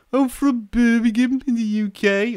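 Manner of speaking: high-pitched, put-on Brummie accent; Birmingham accent. Shown as a demonstration. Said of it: "I could probably do, uh, an accent".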